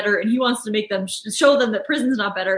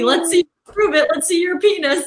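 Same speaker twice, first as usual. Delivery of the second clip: Michael Scott impression; 6 semitones higher than usual. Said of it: "and then he says to Toby".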